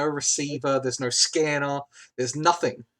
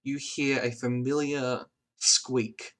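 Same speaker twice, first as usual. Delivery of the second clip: slow echoing narration; 3 semitones lower, 4 dB quieter than usual. A narrator's reading. GM narrates echo scene.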